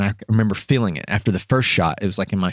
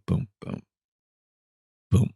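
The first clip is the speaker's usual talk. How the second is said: faint, soft thuds with long gaps; imitated heart slowing to a stop; heartbeat dying out; 6 dB quieter than usual. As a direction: let the last beats fade away. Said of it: "it just slows down".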